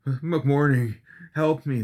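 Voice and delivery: high, strained wheeze of a dying man; wheezing. Acted. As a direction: gasp it out, barely breathing. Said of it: "the man wheezed".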